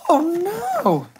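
high, dismayed cry; squeaky storyteller dismay; mock horror. A narrator's reading. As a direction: react aghast for the children. Shocked at house falling.

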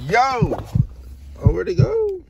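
high, loud frantic shouts; frantic at the vanishing message; panicked yelling. Real, not acted. Boyfriend losing the message, yelling frantically.

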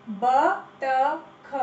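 बतख drawn out slowly as ब-त-ख; syllable by syllable. Shown as a demonstration. Teacher sounding out बतख for children. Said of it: "सही उच्चारण करो".

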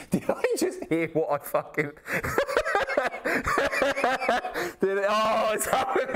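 cracking up in disbelief; high, helpless laughter breaking up the words; laughing. Real, not acted. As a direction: talk through a real laughing fit. Cracking up at what he heard.

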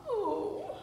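high little whimper; mock whimper. Reader acting baby llama missing his mama, mock whimper. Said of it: "whimpers softly for his mama".

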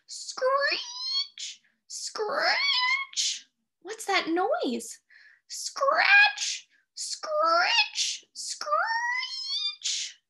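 high, eerie screech sound; screechy sound effect. Acted. Storyteller making the screeching window noise. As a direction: scrape out the screech like a branch.

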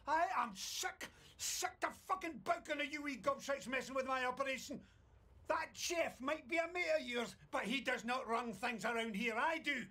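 bellowing in rage; furious shouting. Acted. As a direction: yell it right in his face. Boss berates worker.

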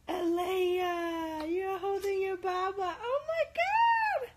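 high, loud tearful cries with a laugh; crying happy shout. Real, not acted. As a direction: shout out overwhelmed with happy tears. Baby holds her bottle.

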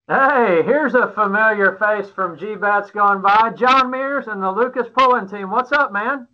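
upbeat on-air guest welcome; show host introduction. Acted. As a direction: announce the guest to viewers, then greet him.

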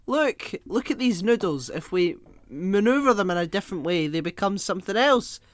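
loud, hammed-up Italian accent; showman's spiel with a comic Italian accent; mock Italian accent. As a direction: pitch the idea eagerly in a put-on Italian accent. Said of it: "when I go for an Italian".

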